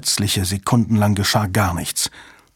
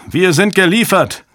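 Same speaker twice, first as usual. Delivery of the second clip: high-pitched cry of doom; panicked shout; 8 semitones higher, 4 dB louder than usual. A narrator's reading. Technician to the room as all is lost, shouting. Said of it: "schrie ein Techniker".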